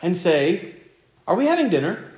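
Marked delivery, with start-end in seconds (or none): 1.3-2.1 put-on sweet child's question. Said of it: "walk into the kitchen sweetly and say".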